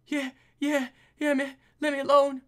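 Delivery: high, put-on scared crook; high thug voice. Voicing a thug.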